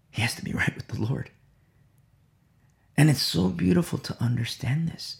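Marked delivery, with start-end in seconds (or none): 0.1-1.3 hushed, quick whisper. Real, not acted. Preacher to congregation, urgent whisper. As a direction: breathe it like a secret, fast. Repeats key point.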